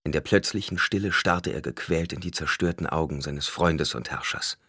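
ordinary delivery, nothing marked

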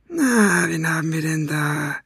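menacing, high-pitched villain voice; Basta. A narrator's reading. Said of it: "fragte er mit bedrohlich leiser Stimme". (bedrohliche, hohe Schurkenstimme)

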